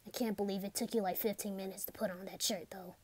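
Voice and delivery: ordinary talk, nothing marked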